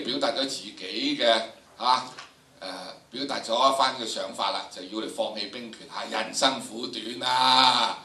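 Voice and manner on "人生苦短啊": usual speaking voice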